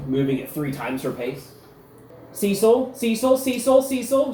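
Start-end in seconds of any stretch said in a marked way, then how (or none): 2.3-4.3 high, loud repeated name-call; pitched-up, insistent hailing; urgent calling out. Acted. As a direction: call after someone striding away from you. Hurrying to intercept a striding man.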